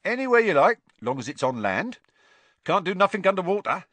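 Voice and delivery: high, loud, fast at the end; proud boast. A narrator's reading. Stan proudly tells Harry where the bus goes. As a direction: brag about the bus. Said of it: "said Stan proudly".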